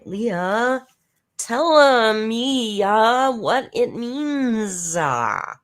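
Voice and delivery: mocking halting speech. Mocking the streamer's new way of speaking, high and slow with trailing uhs. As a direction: parody her stop-start uh at the end of everything. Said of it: "'cause that's how she talks now, with "uh" at the end".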